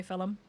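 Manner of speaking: "film" said as "fillum"; regional pronunciation. Shown as a demonstration. Explaining regional speech, two-syllable "fillum". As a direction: add a vowel to "film": "fillum". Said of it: "certain parts of Northern Ireland".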